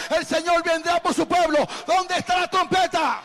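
high, loud revival exhortation; full-force preaching. Real, not acted. Pastor to congregation about the coming trumpet, full-force preaching. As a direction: shout it out to rouse the church.

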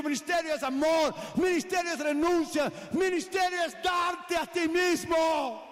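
loud, rising preacher's cry; preaching at full force. Acted. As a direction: hammer each line home to the congregation.

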